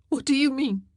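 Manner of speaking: high, alarmed question; crying out. A narrator's reading. Wife cries out at husband's threat, alarmed. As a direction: cry it out in alarm. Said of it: "she cried".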